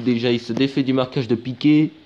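normal talking voice

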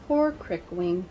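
soft, pitying sigh; sympathetic. A narrator's reading. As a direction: feel sorry for him, softly. Narrator pities hero.

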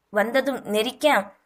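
ordinary delivery

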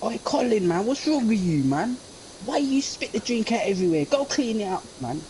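high, scolding mate; mock scolding friend. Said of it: "He's all like".

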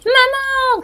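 high loud call; boy calling out for mum; frightened child's cry. A narrator's reading. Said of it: "crie-t-il".

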